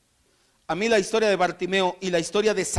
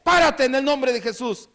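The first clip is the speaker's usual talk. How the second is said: sudden shout; 4 semitones higher than usual. Acted. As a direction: shout a command so heads turn. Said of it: "de repente llegáramos y le gritara".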